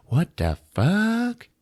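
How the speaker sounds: high, slow, drawn-out disbelief; mock bewilderment. Kirsty seeing blood soak the sheet, mock bewilderment. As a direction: stretch it out, rising in pitch.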